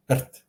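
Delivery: "rt" as a short trill closed by T, no vowel; syllabic rolled R. Shown as a demonstration. Teacher modelling for students to try, vowelless trilled "rt". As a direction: trill straight into the T of "rt". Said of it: "Just like that".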